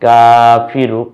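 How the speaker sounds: Shahida stretched syllable by syllable, each vowel held long; slow drawn-out reading. Shown as a demonstration. Teacher to learners, showing the joined word, very slow. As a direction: draw out Shahida slowly and clearly. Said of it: "এরপরে".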